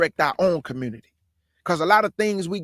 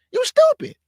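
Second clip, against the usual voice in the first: sudden much higher scornful snap; scornful jab; 5 dB louder than usual. Real, not acted. To people crying for Charlie, a high scornful snap. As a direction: spit the insult out high and sharp.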